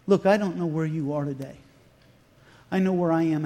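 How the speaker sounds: ordinary talk, nothing marked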